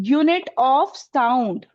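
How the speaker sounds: very slow, word by word; slow definition. Shown as a demonstration. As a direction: drag each word out for the class to note down. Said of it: "syllable का मतलब होता है".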